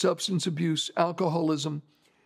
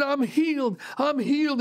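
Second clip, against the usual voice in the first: higher-pitched mock cry; retold shouting; 6 semitones higher than usual. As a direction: imitate a healed man crying out. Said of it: "He shouted at the top of his lungs".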